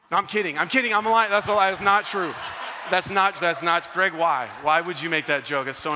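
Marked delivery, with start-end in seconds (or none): none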